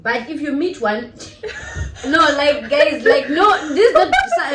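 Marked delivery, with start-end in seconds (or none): 2.1-4.5 flustered giggling denial; laughing. Real, not acted. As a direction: laugh it off nervously. Laughing at her own remark.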